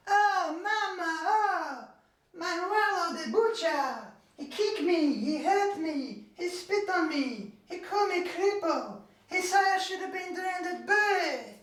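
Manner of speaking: very high, slow, wailing complaint to Mama; whining son. Acted. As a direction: whimper it out like a beaten boy. Hooky complains to his mother.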